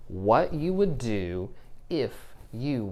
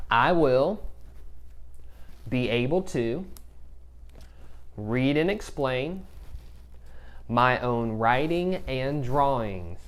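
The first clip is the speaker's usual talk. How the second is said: slow, clear read for repeating; slow model reading. Shown as a demonstration. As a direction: read it slowly so kids can echo. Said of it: "I want you to repeat that after me".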